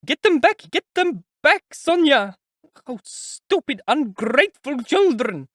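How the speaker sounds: high, loud frantic cries; sobbing mother yelling for help, high and loud; desperate shouting. A narrator's reading. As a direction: scream after them while running. Mother chasing children, sobbing.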